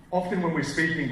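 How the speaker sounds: normal talking voice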